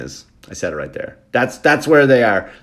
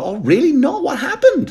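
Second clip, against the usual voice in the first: fake innocent surprise. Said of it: "I go".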